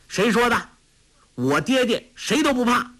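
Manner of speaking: put-on high, defiant boy's voice. A narrator's reading. Tolui defends his father, defiant and high. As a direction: stand up proudly as a child. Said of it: "昂然说".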